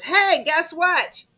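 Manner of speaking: loud, high, drawn-out attention-getter; eager announcement. Acted. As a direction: burst in with big news, stretched out. Mother figure eagerly grabs your attention.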